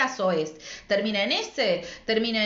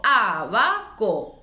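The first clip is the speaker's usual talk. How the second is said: abaco split into a... ba... co, first A strongest; slow, spaced-out A-BA-CO for abaco; separated syllables; 4 dB louder, much slower than usual. Shown as a demonstration. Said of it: "Yo separo y digo".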